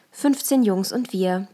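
ordinary delivery, nothing marked

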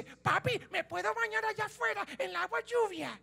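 put-on high, soft child pleading; little boy voice. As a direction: ask Papi like a small son. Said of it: "tu hijo te dice". (voz de niño pequeño, aguda y suave)

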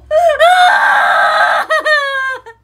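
hammed-up high-pitched wail; long, screaming mock bawl; khóc lớn hơn. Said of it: "Tôi khóc lớn hơn luôn". (gào khóc giả, cao vút, kéo dài)

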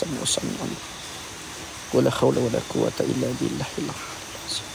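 low, soft prayer under the breath; murmured prayer. Real, not acted. Host murmurs prayers before entering, softly. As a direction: pray quietly to steady yourself.

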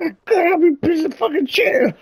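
very high, strained laughing voice; mock laughing whine. Retelling how he'd answer her, very high mock whine. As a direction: squeak it like you can't stop laughing. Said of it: "be like".

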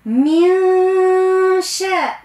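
من with the nun hidden into a nasal an; nasal من, no clear n. Shown as a demonstration. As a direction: sing من with a French nasal ending. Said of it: "ماهيش شاء".

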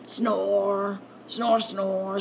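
drawn-out snore sounds; mock snoring. Narrator imitating Rabbit's snores, mock snoring. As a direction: snore it out sleepily.

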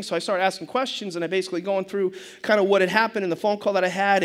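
ordinary talk

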